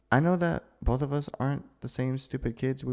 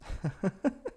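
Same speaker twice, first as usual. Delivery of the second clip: nervous laugh; 9 semitones higher, 5 dB quieter than usual. Acted. Nervous laugh after confession.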